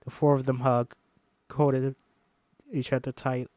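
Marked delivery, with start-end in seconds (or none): none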